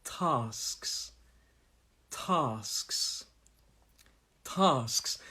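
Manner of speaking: TASKS said slowly as "tahsks", spaced for repeating; southern long "ah". Shown as a demonstration. Teacher asks learners to repeat, southern TASKS with long "ah". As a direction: model TASKS with long "ah", leaving gaps. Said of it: "Repeat after me".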